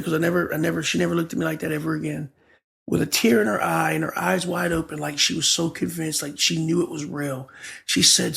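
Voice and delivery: ordinary talk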